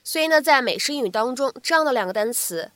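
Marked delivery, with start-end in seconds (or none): none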